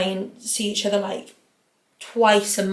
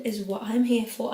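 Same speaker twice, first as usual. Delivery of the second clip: normal talking voice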